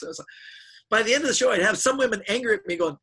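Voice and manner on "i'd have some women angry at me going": usual speaking voice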